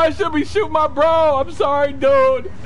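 loud, high, panicked apologizing; frantic screamed apology. Real, not acted. Gamer who burnt teammate by accident, frantic screamed apology. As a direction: yell your sorries in a horrified rush.

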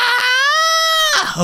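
long, high stretch cry; exaggerated bedtime stretch scream; loud stretching yell. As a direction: strain up into a drawn-out "aah". Play-acted nighttime stretches.

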